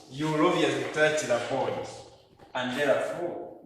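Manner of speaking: usual speaking voice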